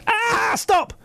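high-pitched squealed protest; tickled little boy. Retold or imitated. Dad retelling his son's tickling, imitated high squeal. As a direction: squeal it like a small child being tickled. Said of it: "I was tickling my little boy".

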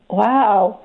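usual speaking voice

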